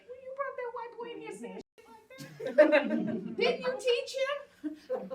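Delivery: high, slow, scolding grandmother voice; Big Mama scolding. Retold or imitated. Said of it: "Big Mama saying".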